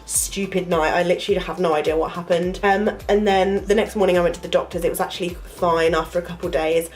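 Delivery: normal talking voice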